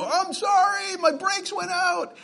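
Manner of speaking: very high-pitched mock yelling, drawn out; imitated yell. Driver yelling apology out his window, mock high yell. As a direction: call out like a panicked driver, hammed up. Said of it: "He's yelling out his window".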